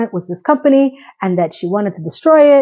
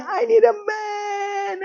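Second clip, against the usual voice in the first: high, slow, soft wailing sob; drawn-out fake weeping plea; mock crying; 12 semitones higher, 6 dB quieter, much slower than usual. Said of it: "And she's crying".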